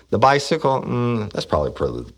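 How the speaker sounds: usual speaking voice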